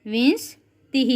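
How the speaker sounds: drawn out so the class can copy विंशतिः; careful pronunciation. Shown as a demonstration. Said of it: "क्या बोलेंगे इसको हम?".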